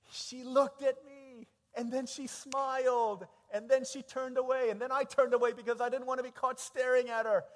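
loud, high, breathless thrill of a crush; excited storytelling. Acted. Preacher reliving a cafeteria crush, loud and giddy. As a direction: build it up bigger and bigger with giddy joy.

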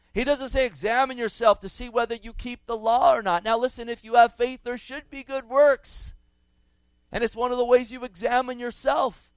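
ordinary delivery

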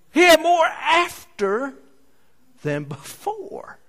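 high, slow, wondering exclaim; amazed exclaim. Acted. Preacher marvelling at leftover food, high amazed. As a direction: marvel at the miracle.

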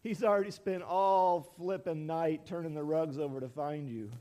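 normal talking voice

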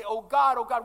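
loud, urgent crying out to God; a preacher showing how to cry out, loud. Shown as a demonstration. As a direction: call out to God with full force. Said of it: "we gotta cry out and say".

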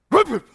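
high, loud hollow whoop whoop; imitated grenade whoop. Shown as a demonstration. Showing the crowd how a grenade sounds, imitated whoop. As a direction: mimic the grenade's concussion. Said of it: "like that".

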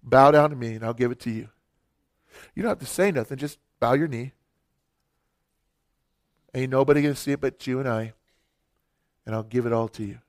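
a hint of a low, soft tempter; tempting devil. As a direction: coax like the devil offering the world. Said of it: "He shows him the kingdoms".

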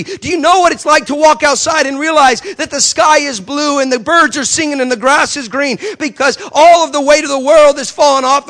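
rising, loud, fervent preaching. Retold or imitated. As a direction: build it up like an altar call. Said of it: "I started preaching in the middle of the airport".

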